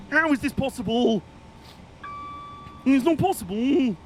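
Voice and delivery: high, hammed-up incredulity; mock disbelief. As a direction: protest in melodramatic disbelief. Mock outrage at being overtaken.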